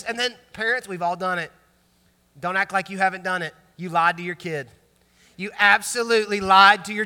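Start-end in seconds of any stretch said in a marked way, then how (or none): none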